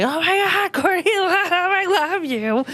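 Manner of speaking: mock-adoring squeal of a young fan; gushing fan. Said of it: "I was like".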